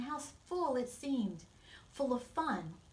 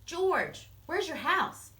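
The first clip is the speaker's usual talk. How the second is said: put-on high, loud surprised question from a puzzled child; puzzled and surprised, voice raised; 7 semitones higher, 7 dB louder, slower than usual. A narrator's reading. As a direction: ask it in surprise, pitch jumping up. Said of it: "Ben was surprised".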